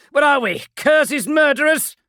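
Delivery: high, loud impassioned plea; rally your brothers to mercy; impassioned marine. Acted. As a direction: Kelefon pleads for mercy, loud and passionate. Kelefon retorts.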